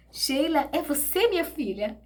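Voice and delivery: high astonished question; emotional disbelief. Retold or imitated. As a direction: ask hoping it's really her. Recognising daughter.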